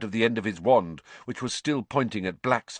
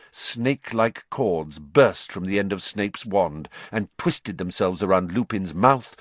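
plain reading voice

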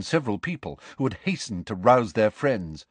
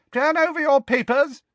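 put-on high, louder old examiner's call; elderly examiner; 5 dB louder than usual. A narrator's reading. Old examiner starting the exam for the hall, reedy call. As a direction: announce it to the whole hall like an ancient examiner.

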